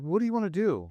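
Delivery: mother demanding an answer from her son; mom's pressing question. Retold or imitated. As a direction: push him like a worried mother. Mom questioning son, retold.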